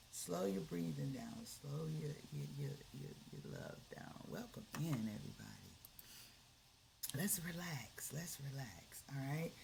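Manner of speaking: soft whispered calming; guided relaxation. Acted. Leads a relaxation exercise.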